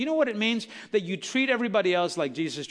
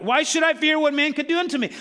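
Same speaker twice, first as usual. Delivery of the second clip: ringing proclamation; 8 semitones higher, 5 dB louder than usual. Acted. Quoting scripture at full force.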